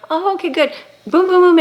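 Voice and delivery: high, loud mock-cheerful quick fixes; mock easy fix. Pretending God instantly fixes everything, high and loud mock-cheer.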